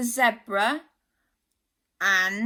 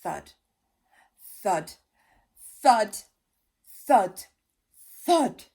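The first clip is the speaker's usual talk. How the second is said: approaching thuds, growing louder; 7 dB louder than usual. A narrator's reading. Monster approaching.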